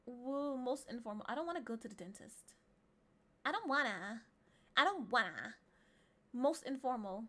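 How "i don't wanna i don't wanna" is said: mildly hammed-up childish whine; mock whining. Streamer balking at a dentist visit, mock whine. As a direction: whine it like a reluctant kid, second time louder.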